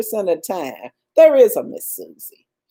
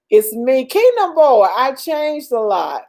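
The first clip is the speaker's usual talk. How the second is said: ordinary talk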